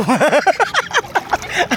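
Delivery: real burst of laughter; hearty laugh. Real, not acted. Uncle teasing nephew about shallow water, hearty laugh.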